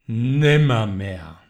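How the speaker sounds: low, slow, hollow croak of a bird; raven's answer. Acted. The raven answers the pleading man, slow and low.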